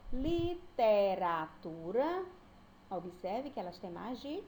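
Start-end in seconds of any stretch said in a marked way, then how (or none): 0.1-2.2 "literatura" slowly and louder as li, te, ra, tu, ra; one syllable at a time. Shown as a demonstration. Teacher giving a many-syllable example, "literatura" slowly split up. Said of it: "eu falo cada sílaba".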